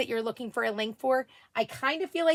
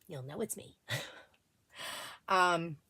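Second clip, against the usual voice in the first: normal talking voice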